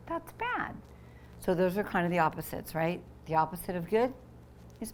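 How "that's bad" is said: that's Bad said much higher and softer. Shown as a demonstration. Reading the title, high soft Bad.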